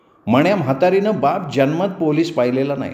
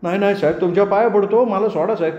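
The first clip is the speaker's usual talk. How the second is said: begging; 6 semitones higher, faster than usual. A narrator's reading. Kerba begs the constable.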